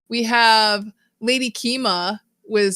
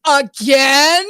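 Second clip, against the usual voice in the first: exasperated cry; 4 semitones higher, 9 dB louder than usual. Retold or imitated. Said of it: "they're like".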